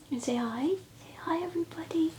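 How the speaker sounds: hushed, breathy prompt to the puppy; whisper to puppy. Real, not acted. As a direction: whisper it softly at the dog.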